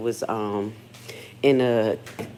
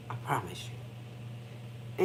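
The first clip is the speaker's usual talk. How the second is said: slow whispered promise; hushed, very slow confession; 8 semitones lower, 14 dB quieter, much slower than usual. Real, not acted. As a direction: lean in and whisper it, slowly. Confiding aside about her money.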